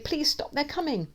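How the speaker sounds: high, anxious plea; begging. A narrator's reading. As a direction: implore them to stop. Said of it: "I beg".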